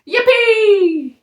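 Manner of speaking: high, loud whoop; cry of joy. A narrator's reading. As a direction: whoop with glee. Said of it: "the tees cried out".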